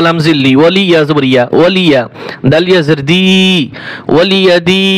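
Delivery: usual speaking voice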